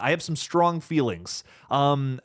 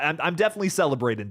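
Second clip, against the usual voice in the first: ordinary talk, nothing marked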